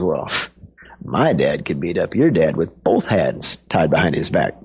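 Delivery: low, lightly voiced boastful kid; one-up him in a low mock-boy voice; bragging boy. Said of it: "it's just kids bragging".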